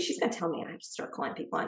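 quick, breathless whispered thought. Retold or imitated. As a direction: whisper it to yourself, rushing. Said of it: "And I figured".

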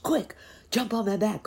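high, pressing command; high, urgent horse to the rescue; urgent call. A narrator's reading. Horse urges friends to escape.

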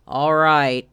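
loud, glum donkey voice; resigned Eeyore. Acted. Eeyore answers the call to start, resigned and glum. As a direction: give in grudgingly.

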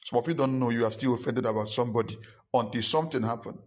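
normal talking voice

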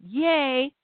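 high, mock-delighted cheering; mock celebration the Pharisees never gave; sarcastic mock cheer. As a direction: gush sarcastically like a thrilled fan. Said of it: "none of the Pharisees are rejoicing".

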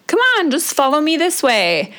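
put-on high coaxing boyfriend voice; light impression of an eager boyfriend. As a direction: lightly mimic him urging you on. Boyfriend voiced in ski story.

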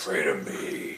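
very low, commanding voice; mock deity. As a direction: intone it low like a mock god. Mock godlike command in game.

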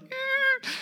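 high squeak of glee; a kid's tiny high squeal; squeaky giggle sound; excited squeak. Retold or imitated. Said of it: "She's like".

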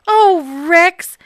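gasping. Acted. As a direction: gasp his dog's name. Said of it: "gasped Bob".

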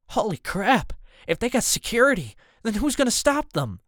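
alarmed and incredulous; alarmed outburst. A narrator's reading. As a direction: blurt it out in rising alarm. Startled reaction to deadly threat.

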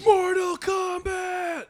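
booming high announcer yell, drawn out; game announcer shout. Retold or imitated. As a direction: shout the title like the arcade game. Echoing the game's announcer title call.

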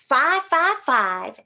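slow, loud, emphatic; call and response. Acted. Myrtle leading the audience chant, slow. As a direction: count it out for the crowd. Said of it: "say it with me".

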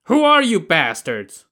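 high, loud angry yell; shouting. Acted. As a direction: bellow it at the strangers. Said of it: "he started shouting".

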